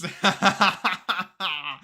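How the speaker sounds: loud, high burst of real laughter; big laugh. Real, not acted. Streamer laughing at the poll for chat, loud laugh.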